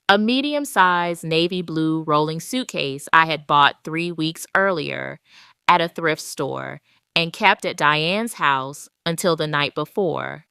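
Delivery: ordinary delivery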